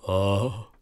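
long soupir triste; soupir du dragon. Acted. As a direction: soupire de solitude. (soupir rêveur du dragon)

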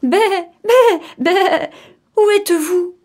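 very high, loud bleating call; bleating mother goat. Acted. Mother goat searches cellar to attic, urgent bleating call. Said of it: "dit-elle encore".

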